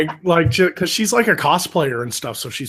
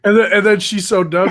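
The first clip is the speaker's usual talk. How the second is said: usual speaking voice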